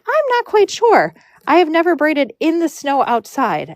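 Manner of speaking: very high voice at first, easing down; imitating doubtful friend. Friend facing braiding in snow, high-pitched retold doubt. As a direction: mimic a friend's high, uncertain reply.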